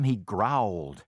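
ordinary delivery, nothing marked

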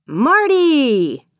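high, loud drawn-out name call; excited shout. A narrator's reading. Avery shouts to the dog at the door, excited shout. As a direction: shout the name in one long swoop. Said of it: "Avery shouted".

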